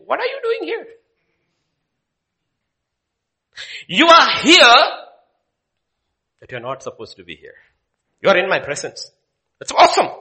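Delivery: high, loud voice of God; God speaking. Acted. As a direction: call him out as God. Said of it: "hear from God whispering".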